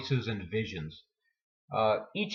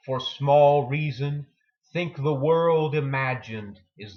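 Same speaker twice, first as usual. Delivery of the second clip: slow, loud declaiming of a poem; poem reading; 2 semitones higher, 5 dB louder, slower than usual. Acted. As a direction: give each line weight like a performed recital. Reads Stevens poem aloud.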